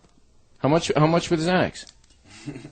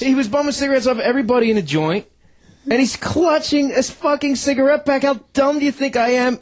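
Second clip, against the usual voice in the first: indignant rant; 8 semitones higher than usual. Real, not acted. Indignant rant about the lie.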